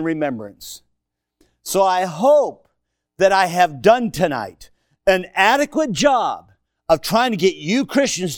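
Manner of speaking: loud, high fired-up sermon; preaching at full force. Acted. Pastor urging the Christians, loud and fired up. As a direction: drive it home to the church.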